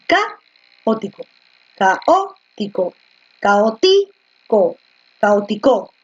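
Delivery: "caótico" drawn out slowly, each time hitting the O; stress on the O. Shown as a demonstration. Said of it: "La fuerza de voz recae aquí, en la O". ("caótico" lento, con la fuerza de voz en la O)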